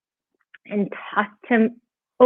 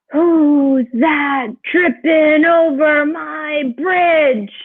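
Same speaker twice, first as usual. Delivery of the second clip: drawn-out, higher storybook troll roar; 6 semitones higher, much slower than usual. A narrator's reading. Troll challenging whoever crosses the bridge, roaring. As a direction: bellow it slowly as a troll, stretching each word. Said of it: "the troll roared".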